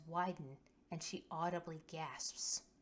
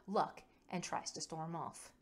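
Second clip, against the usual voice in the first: usual speaking voice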